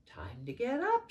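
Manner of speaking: ordinary delivery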